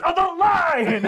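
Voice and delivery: high, drawn-out loud shout; irate captain. Acted. Captain correcting Kaz about a rope, high drawn-out yell. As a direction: stretch it out in a mocking yell. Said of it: "and the captain goes".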